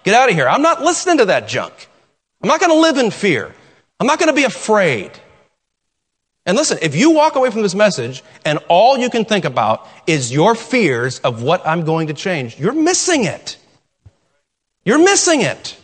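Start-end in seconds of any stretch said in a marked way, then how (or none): 0.0-5.1 standing firm against fear, rising rebuke; defiant rebuke. Retold or imitated. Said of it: "build that wall and say". 12.6-15.8 higher, pressing repeat; emphatic preaching. Real, not acted. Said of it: "preacher presses the point".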